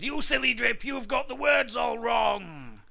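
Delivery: loud, high scolding yell; exasperated sibling shout. A narrator's reading. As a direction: yell at the silly brother. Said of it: "the others yelled".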